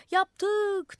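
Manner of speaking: high, loud, stretched call; crowd shout. A narrator's reading. Crowd answering the madman on the roof, shouting. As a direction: holler it up to the roof. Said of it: "diye bağırdılar".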